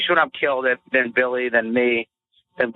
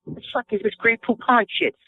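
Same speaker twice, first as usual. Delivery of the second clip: hammed-up whiny rant, pitched higher; mock tantrum; 7 semitones higher than usual. Comic voicing a touring comic's petty rant about mayonnaise, hammed-up whine. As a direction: throw a petty diva fit over the mayo.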